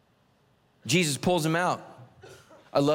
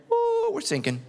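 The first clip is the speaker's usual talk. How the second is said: mock panic. Panic joke about marriage.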